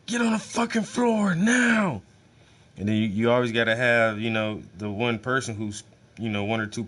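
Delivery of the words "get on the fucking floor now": high, slow, commanding raid yell; soldier barking orders. Retold or imitated. Soldier yelling at a family during a home raid, hammed-up command. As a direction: bark it like a soldier storming a house. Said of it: "and be telling these motherfuckers".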